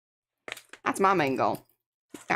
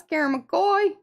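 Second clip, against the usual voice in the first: slow, drawn-out, higher and punchier on "That's Karen McCoy"; emphatic name call; 7 semitones higher, 3 dB louder, slower than usual. Shown as a demonstration. Greeting viewer Karen on stream, slow emphatic announcer call. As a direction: announce her name like a big arrival. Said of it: "I like saying it like that".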